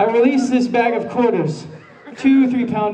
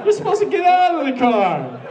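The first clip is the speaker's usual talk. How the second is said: truck driver; 7 semitones higher than usual. Retold or imitated. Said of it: "Mister Monster Truck is like".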